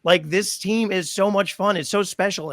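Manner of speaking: mock-tearful, moved; emotional. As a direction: let it crack with feeling. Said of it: "I'm like".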